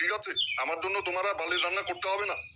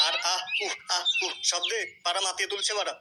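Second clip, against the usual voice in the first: mock moaning. Gossip imitating moans.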